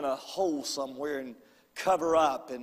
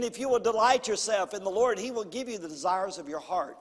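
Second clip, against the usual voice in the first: ordinary talk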